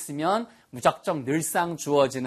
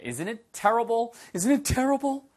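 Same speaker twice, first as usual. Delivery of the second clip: slow and rising, leave room to repeat; slow model line; 7 semitones higher, much slower than usual. Shown as a demonstration. Teacher models the line for students to repeat. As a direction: model it, then give it back. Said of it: "your turn".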